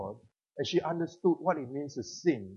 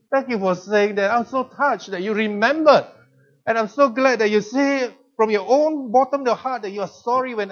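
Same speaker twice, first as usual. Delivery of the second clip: louder, higher, full of emotion; moved, grateful thanks; 5 semitones higher, 7 dB louder than usual. Retold or imitated. Father thanking his daughter, loud and deeply moved. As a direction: pour out grateful thanks to her.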